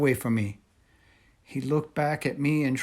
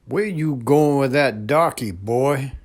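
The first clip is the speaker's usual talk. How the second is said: put-on a much louder yell at the boy; 4 semitones higher, 10 dB louder than usual. A narrator's reading. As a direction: bark it out as a hostile soldier. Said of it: "one yelled at me".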